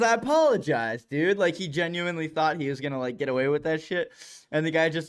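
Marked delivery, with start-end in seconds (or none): 0.0-1.4 quoting through laughter, high; laughing imitation. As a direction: giggle out the apology. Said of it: "He says".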